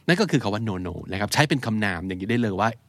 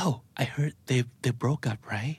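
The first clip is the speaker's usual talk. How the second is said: softer, slow gossip voice; gossipy hush; 7 dB quieter, much slower than usual. Retold or imitated. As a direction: lean in and share the rumour quietly.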